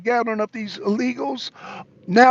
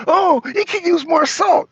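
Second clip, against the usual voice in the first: fussy customer; 9 semitones higher, 4 dB louder than usual. Retold or imitated. Customer critiques soup.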